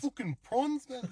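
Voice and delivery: high-pitched mock South African; South African accent. Co-host quoting the film, high South African accent. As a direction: exclaim it in a District Nine South African accent. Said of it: "it all goes back to District Nine".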